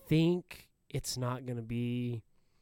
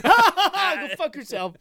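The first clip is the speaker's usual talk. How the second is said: slow, drawn-out, laughing hard; 14 semitones higher, 8 dB louder, slower than usual. Real, not acted. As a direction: stretch it out, cracking up. Repeating it, laughing harder.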